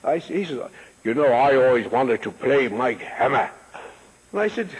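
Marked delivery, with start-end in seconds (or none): 1.0-3.5 deep, grand British voice; low-voiced theatrical British impersonation; Cedric Hardwicke. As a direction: imitate a stately British actor, pitched low. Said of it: "beautiful, deep voice. He had a British".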